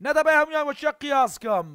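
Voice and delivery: normal talking voice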